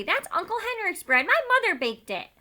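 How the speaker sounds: high, loud indignant protest; angry. A narrator's reading. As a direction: scold the soldier for spoiling it. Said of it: "she said, angry".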